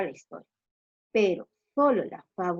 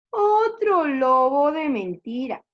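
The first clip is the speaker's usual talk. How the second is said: higher, loud, slow drawn-out thought; disbelieving villagers; 5 semitones higher, 7 dB louder, slower than usual. Acted. Villagers think it another prank, dismissive. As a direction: stretch it out dismissively. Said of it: "pensaron los campesinos".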